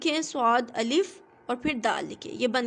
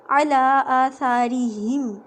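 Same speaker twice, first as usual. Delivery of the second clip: slow, louder Quranic recitation of عَلَىٰ آثَارِهِمْ with a thin ra; recited example; 5 dB louder, slower than usual. Shown as a demonstration. Teacher recites a Quran example for the class, slow and ringing. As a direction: chant عَلَىٰ آثَارِهِمْ slowly and fully, ra kept light. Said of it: "اس کے نیچے کیا ہے".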